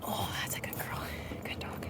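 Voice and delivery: soft whisper of praise to the dog. Real, not acted. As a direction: hush the praise so she stays put.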